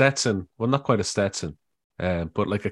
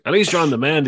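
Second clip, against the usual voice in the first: louder repeat; 6 semitones higher, 4 dB louder than usual. Retold or imitated. Repeated louder to confused man.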